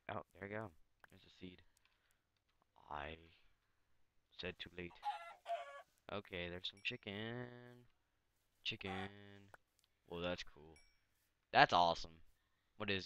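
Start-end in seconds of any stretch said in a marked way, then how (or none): none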